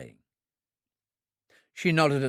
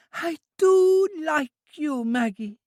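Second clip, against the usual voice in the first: put-on high, slow childlike voice, stress on do; a child full of fondness; high drawn-out childlike affection; affectionate Trudy; 4 dB louder, slower than usual. A narrator's reading.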